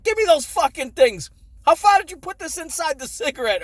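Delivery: shrill-sounding mom demanding answers, hammed up; imitating angry mom. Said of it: "She's like".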